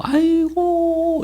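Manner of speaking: very high, long-drawn mourning wail; imitated wailing. Family at the hospital deathbed, imitated high wail. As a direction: act out a drawn-out cry of grief. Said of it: "이러면 울면서".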